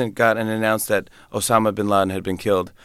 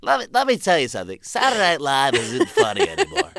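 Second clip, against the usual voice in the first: higher, hammed-up DJ taunt; mock zoo DJ; 4 semitones higher than usual. Morning DJ provoking the comedian, mock DJ. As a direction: needle the guest on air. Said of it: "radio shows".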